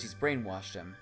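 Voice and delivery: ordinary delivery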